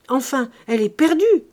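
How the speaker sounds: high, loud exasperated outburst; irritated cry. A narrator's reading. Lazare crie au médecin, haut et fort, irrité. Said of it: "cria Lazare dans une sorte d'irritation".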